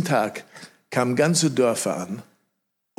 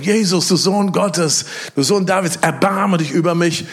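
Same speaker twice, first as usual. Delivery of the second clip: crying out; 5 semitones higher, 4 dB louder than usual. Retold or imitated. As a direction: cry out to Jesus for mercy, high and loud. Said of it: "der fängt an zu schreien".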